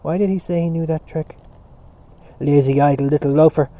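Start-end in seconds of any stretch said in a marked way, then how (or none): 2.4-3.7 loud, angry name-calling; cried insult. A narrator's reading. Prefect berating a schoolboy as a loafer, crying out. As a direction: hurl the insult loudly at the boy. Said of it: "cried the Prefect of Studies".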